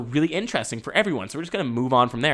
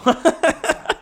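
giggling laugh; 6 dB louder than usual. Real, not acted. Laughs at own joke.

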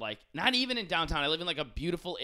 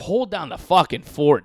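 much louder, slow emphatic; loud echo; 4 semitones higher, 13 dB louder than usual. Real, not acted. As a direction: blast the phrase. Echoing friend, amped up.

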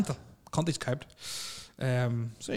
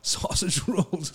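drawn out; 5 semitones higher, much slower than usual. Pretend. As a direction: stretch it out for comic effect. Savouring the silly headline.

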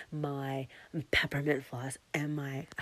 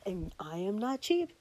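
higher, lightly voiced admiring reaction; imagined date's praise; 9 semitones higher than usual. Imagining her date's reaction to the gift, light gushing. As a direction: play his imagined gushing reply. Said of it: "it's gonna be like".

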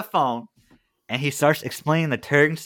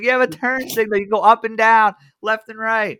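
insistent salesman; 8 semitones higher, 3 dB louder than usual. Retold or imitated. Salesman explaining turn signal.

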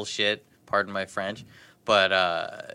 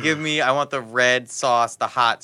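louder insistent order; 3 semitones higher, 7 dB louder than usual. Retold or imitated. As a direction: push the order hard. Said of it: "I said".

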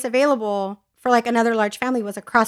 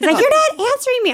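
put-on much higher, whiny child protest; whiny kid; 13 semitones higher, 4 dB louder, faster than usual. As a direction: whine it like a thwarted little boy. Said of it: "He's like".

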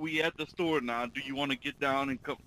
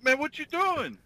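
high excited quote; 11 semitones higher than usual. Retold or imitated. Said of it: "I said".

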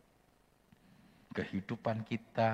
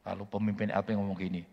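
ordinary talk

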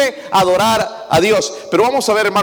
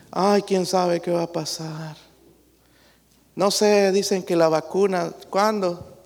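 preocupado; 6 dB quieter, slower than usual. Retold or imitated.

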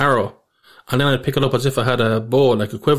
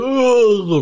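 high, drawn-out "oh" of revulsion; disgusted groan; 11 semitones higher, 3 dB louder than usual. Retold or imitated. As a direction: act out recoiling in disgust. Acts out disgust at soiled finger.

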